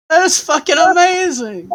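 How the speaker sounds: loud, high, thrilled outburst; excited shout. Real, not acted. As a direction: yell it in delight.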